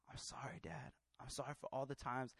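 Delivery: breathy whispered sorry; whispered apology. Retold or imitated. Son whispering sorry to his father at night. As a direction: whisper it at the bedside.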